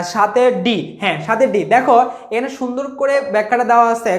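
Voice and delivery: usual speaking voice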